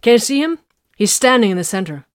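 urgent shout across the din; calling out. Acted. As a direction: point and call it out. Vox pointing, calling over noise.

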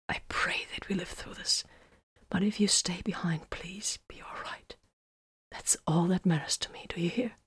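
very soft tender whisper; hushed prayer held close to him. A narrator's reading. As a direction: murmur it into his ear.

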